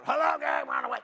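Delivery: loud, big phone greeting; imitating a loud cheerful owner on the phone; booming owner greeting. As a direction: boom it out like a cheerful team boss.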